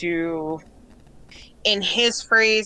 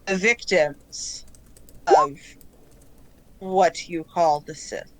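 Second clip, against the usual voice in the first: calm collective voice; 2 semitones lower than usual. Acted. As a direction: speak evenly with long deliberate pauses. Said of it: "you just hear the voices again".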